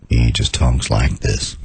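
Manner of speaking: low, very slow, unflustered voice; Doctor Booth. Retold or imitated. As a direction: talk slowly like a doctor nothing can rattle. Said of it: "he, nothing flusters him".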